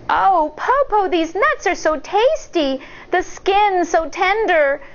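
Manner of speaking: high, lightly voiced girl savoring nuts; child's voice. A narrator's reading. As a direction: tease grandma with how tasty they are. Shang teasing the wolf.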